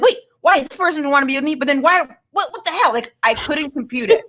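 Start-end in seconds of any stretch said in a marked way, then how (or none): 0.0-2.9 rising baffled disbelief, quick. Retold or imitated. Confused friend asking why she was dumped, higher and faster. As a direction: pile up the questions faster and higher. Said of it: "and I was like".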